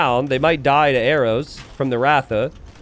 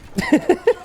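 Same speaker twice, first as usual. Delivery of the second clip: high burst of laughter. Real, not acted. Caster laughs at own remark.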